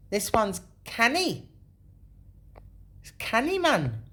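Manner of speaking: high, loud put-on Geordie; attempted Geordie accent. Showing viewers another one, in mock Geordie. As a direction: ham up the Geordie lilt. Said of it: "can't do that accent".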